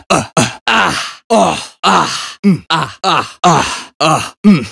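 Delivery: rising strained grunts and gasps; effort grunts. Acted. Agent grunting through a fight, strained.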